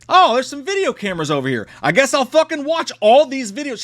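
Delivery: high, mocking voice of a clueless character; mocking imitation. Narrator mocking the dawdling heroine, derisive imitation.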